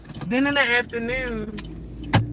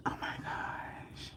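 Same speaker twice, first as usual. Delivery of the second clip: whisper; 19 dB quieter than usual. Real, not acted. Surprised whisper to self.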